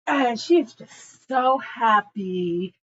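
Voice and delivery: usual speaking voice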